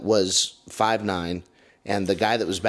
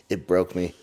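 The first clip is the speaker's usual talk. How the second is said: normal talking voice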